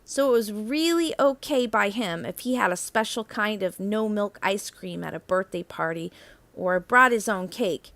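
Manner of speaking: plain reading voice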